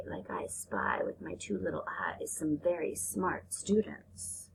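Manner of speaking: soft whispered tease; playful whisper. Acted. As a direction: whisper like a shared secret.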